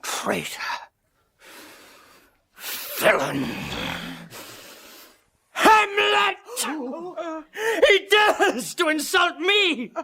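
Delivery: high, furious shouting, building; furious outburst. Acted. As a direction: rage at the servant's insult. Foma explodes at the insult.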